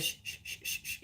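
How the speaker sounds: gentle rocking shh shh shh; rhythmic soft shushing. Shown as a demonstration. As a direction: shush a crying baby in soft, steady beats. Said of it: "you could do that".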